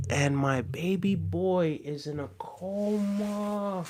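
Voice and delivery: slow down and drop to a hush, drawing out the last word; shocked, sorrowful hush. Real, not acted. Streamer reading a viewer's tragic message aloud, in a stunned hush. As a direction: read it out softly, slowing as the news sinks in.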